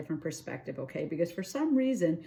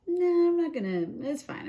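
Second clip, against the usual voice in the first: dismissive ego voice; 9 semitones higher, 3 dB louder than usual. Retold or imitated. Reader voicing the viewer's careless ego: high dismissive brush-off. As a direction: wave it away like a smug ego. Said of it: "Being a little bit like".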